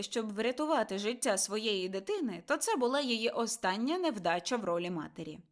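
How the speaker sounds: ordinary delivery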